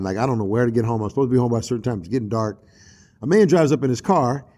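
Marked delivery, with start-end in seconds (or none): none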